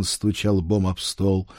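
plain reading voice

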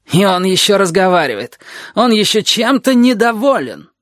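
high-pitched, scornful exclaiming; snorting indignation. A narrator's reading. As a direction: scoff at his nerve, pitch climbing. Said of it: "фыркнула душенька". (возмущённое фырканье)

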